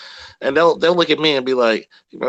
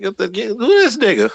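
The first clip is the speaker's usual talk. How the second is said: incredulous grandmother; 10 semitones higher, 3 dB louder, much slower than usual. Retold or imitated. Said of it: "She looked at me like".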